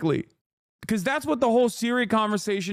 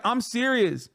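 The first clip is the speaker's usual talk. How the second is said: normal talking voice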